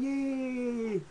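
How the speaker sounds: high mock cheer like the crowd.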